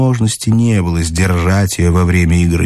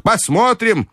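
commanding grandmother; 12 semitones higher than usual. A narrator's reading. Grandmother ordering the narrator to bet, high and commanding.

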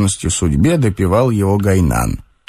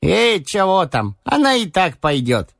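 higher-pitched simple servant voice; cheerful orderly; 6 semitones higher than usual. A narrator's reading. Orderly Gainan tells his master there is no money, carefree higher voice. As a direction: play a carefree orderly with broken Russian.

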